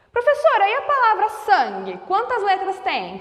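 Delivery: eager student. Acted. Student question, voiced by teacher.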